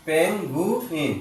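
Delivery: পেঙ্গুইন slowly in three parts, pen-gu-in; broken into syllables. Shown as a demonstration. Teacher repeating for the pupil, পেঙ্গুইন syllable by syllable. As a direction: separate each syllable of পেঙ্গুইন. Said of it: "তাহলে এবার ভেঙে ভেঙে".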